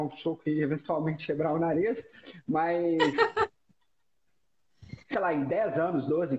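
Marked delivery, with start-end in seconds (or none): 3.0-3.5 short laugh. Real, not acted. Laughs at broken nose.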